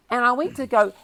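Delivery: ordinary talk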